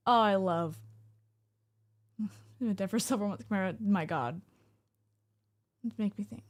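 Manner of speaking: normal talking voice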